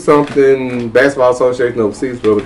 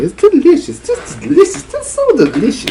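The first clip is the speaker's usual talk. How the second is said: gushing delight; 12 semitones higher than usual. Acted. Praising the vodka, hammed up.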